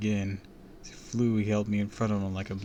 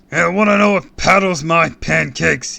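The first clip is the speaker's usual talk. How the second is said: loud, high-pitched villain bellow; booming; 8 semitones higher, 14 dB louder than usual. A narrator's reading. Raging supervillain to the fallen kid, booming. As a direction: boom it out at full force. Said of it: "he boomed".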